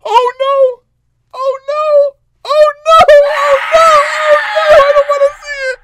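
very high, loud crying shriek; panicked wailing. Real, not acted. Player dreading the jump scare, panicked wailing. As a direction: wail in rising panic.

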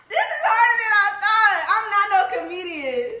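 high-pitched laughing squeal; laughing hard. Real, not acted. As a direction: crack up while talking. Laughing fit while trying to be funny.

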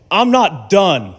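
high, loud, drawn-out insistence; acted-out man refusing to be interrupted; firm interruption. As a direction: cut in slowly and emphatically. Prophetic man cuts the speaker off.

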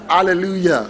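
ordinary talk, nothing marked

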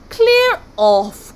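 high, loud dismissal; loud cry. A narrator's reading. Busy sea god sending visitors away, crying out. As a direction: shout them away. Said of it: "cried Agar".

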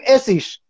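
"اسیش" with final sh; host's "Essig" pronunciation. Shown as a demonstration. Host recalling own way, "اسیش" ending in sh. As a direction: say "اسیش", the last sound a sh.

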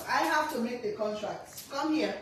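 ordinary delivery

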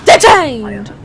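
loud, drawn-out playful shout; mock shouted verdict. Border inspector detaining a traveller, loud mock shout. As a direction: bellow it as a game verdict. Stamps a rejection in game.

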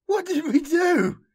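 high, slow anguished wail; sobbing plea. A narrator's reading. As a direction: cry out loudly, tearful and uncomprehending. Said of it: "he sobbed".

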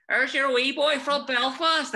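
high, loud, drawn-out heckle from a crowd; imitated crowd shout. Actor imitating Belfast fans at stage door, shouting high and proud. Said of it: "you would always hear in the crowd".

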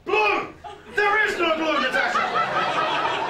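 very high, loud shouted call; loud yell. Retold or imitated. Barry shouting a bet at the roulette table, loud yell. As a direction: bellow it across the table. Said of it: "he reaches across the table and goes".

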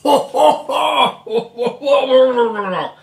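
high, loud burst of laughter at the sour taste; shocked laugh. Real, not acted. Sipping a very sour beer.

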